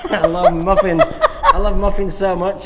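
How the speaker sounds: loud confession through laughter; laughing. Real, not acted. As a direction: say it while cracking up. Laughing joke about muffins.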